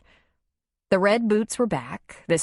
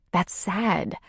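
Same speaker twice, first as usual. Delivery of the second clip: plain reading voice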